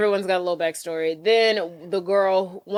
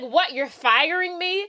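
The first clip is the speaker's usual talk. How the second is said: higher, incredulous questioning; mock shock at being sacked; retold disbelief; 9 semitones higher, slower than usual. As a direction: act out stunned protest, drawn out at the end.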